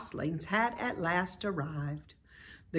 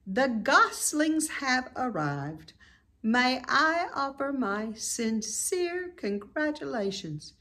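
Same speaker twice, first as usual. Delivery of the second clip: higher, measured, formal voice; Charlotte's announcement; 8 semitones higher than usual. A narrator's reading. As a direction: deliver it like a gracious speech. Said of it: "made the announcement".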